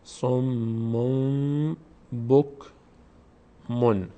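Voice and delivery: slow som-mom... bok... mon, holding the m of سموم; pronounced mim. Shown as a demonstration. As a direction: recite سموم بکمون syllable by syllable. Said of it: "این میم رو باید تلفظ کنیم".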